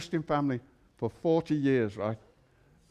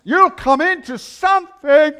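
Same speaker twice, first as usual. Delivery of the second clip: shouted preaching, higher and louder; preaching at full force; 10 semitones higher, 13 dB louder, much slower than usual. Acted. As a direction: build to a shouting climax. Preaching crescendo.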